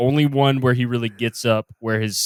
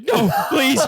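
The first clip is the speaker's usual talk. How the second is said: mock pleading; 10 semitones higher than usual. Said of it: "He's like".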